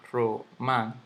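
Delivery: romántico split as ro-mán-ti-co; syllable by syllable. Shown as a demonstration. Teacher sounding out the word for students, separated syllables. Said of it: "aquí lleva su tilde".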